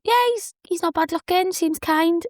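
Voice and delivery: high-pitched, lightly voiced inner thought; mock-coy pleased aside to herself; a girlish private thought about her date; giddy inner thought. Inner thought about date's looks.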